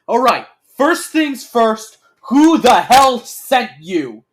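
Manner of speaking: slow, loud, high demand; shout. A narrator's reading. Gunman demands of the group who sent them, shouting slowly. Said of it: "and shouts".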